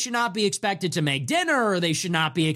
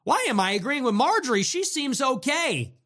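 higher, stress on Why, mock bafflement; mock baffled liberal; 7 semitones higher than usual. Liberal viewers repeating it, higher and more baffled. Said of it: "started to say".

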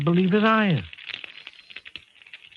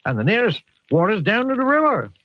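high, worried West Country voice; a flustered Somerset farmer at his wits' end; 10 semitones higher, 4 dB louder than usual. Acted. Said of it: "I feel like he's from the Southwest".

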